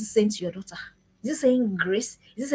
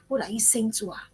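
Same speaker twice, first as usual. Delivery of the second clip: intense whisper; 2 semitones lower, 6 dB quieter than usual. Acted. Urgent question to parents.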